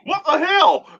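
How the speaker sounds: high surprised exclamation. Real, not acted. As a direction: blurt it out high. Surprised outburst.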